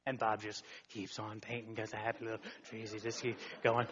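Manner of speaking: hushed low painter's murmur; Bob Ross voice. Retold or imitated. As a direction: softly keep painting, unbothered.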